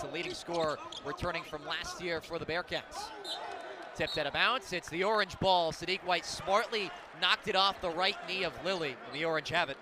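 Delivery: ordinary talk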